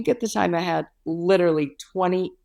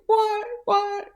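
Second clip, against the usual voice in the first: high, stunned disbelief; mock-shocked high repeat; imitating her own stunned reaction; shocked what. Shocked at bad egg news.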